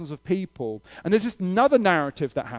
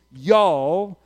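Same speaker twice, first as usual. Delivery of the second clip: drawn-out Southern "y'all"; Southern accent; 3 semitones higher, 6 dB louder than usual. Acted. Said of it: "in the southern accent".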